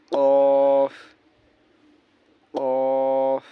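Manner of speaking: each Arabic letter name drawn out very slowly; slow letter recitation. Shown as a demonstration. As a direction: chant the letter names, holding each. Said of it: "Arabic letter recitation drill". (আরবি হরফ খুব ধীরে টেনে টেনে উচ্চারণ)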